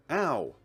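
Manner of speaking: short high, loud "ow"; pained yelp. Acted. Kicked in the shin by his partner, pained yelp. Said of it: "I winced in pain".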